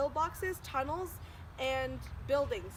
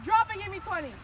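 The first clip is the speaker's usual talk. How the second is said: higher scolding sergeant voice; stern drill sergeant; 8 semitones higher, 6 dB louder than usual. Acted. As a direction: order them down for push-ups.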